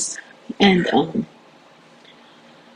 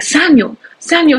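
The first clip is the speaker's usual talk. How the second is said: high calling out of his name; calling out to her boyfriend mid-fight; 8 semitones higher than usual. Retold or imitated. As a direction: call him, pitched high.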